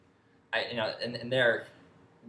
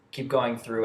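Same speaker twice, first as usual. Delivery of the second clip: ordinary delivery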